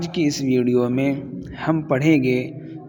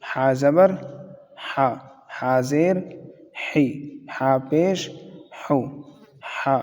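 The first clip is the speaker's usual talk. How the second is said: phonics drill. Shown as a demonstration. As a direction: call out each syllable clearly for learners. Phonics drill reading syllables.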